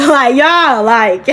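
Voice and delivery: a real uneasy laugh over a heavy memory; nervous laughing. Real, not acted. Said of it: "don't misjudge me because I'm laughing".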